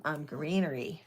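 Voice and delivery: normal talking voice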